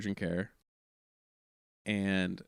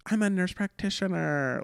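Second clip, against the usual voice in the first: put-on ditzy-lady voice; 9 semitones higher than usual. Audience lady offering to help the comic, high put-on voice. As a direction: do her voice, pitched well up. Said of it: "people with those voices".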